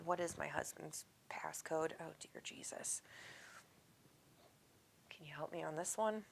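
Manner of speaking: hushed private fretting over a passcode; muttering to herself. Real, not acted. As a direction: whisper a little plea under your breath.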